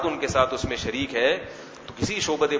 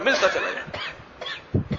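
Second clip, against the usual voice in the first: slow laughing whisper; 5 semitones higher, 5 dB quieter, much slower than usual. Shown as a demonstration. Preacher teasing the sons, ملتا چلا جا رہا ہے in a slow laughing whisper.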